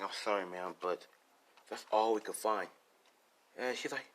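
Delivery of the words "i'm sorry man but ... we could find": low, soft acted condolence; apologetic cop. Cop to the grieving mother, apologetic. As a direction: break bad news quietly and low. Cop breaking bad news.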